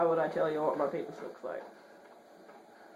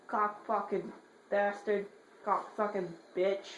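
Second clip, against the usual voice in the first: usual speaking voice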